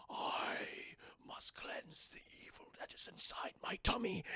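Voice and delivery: gasp, then soft whispered menace; mock-ominous hushed voice; possessed whisper. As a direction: whisper it like a man in a trance. Possessed Justin acted out.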